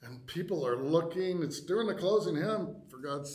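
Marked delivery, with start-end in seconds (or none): 0.0-0.1 breathless whispered dread; aghast whisper. Retold or imitated. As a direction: whisper it, caught off guard. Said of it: "And I'm like".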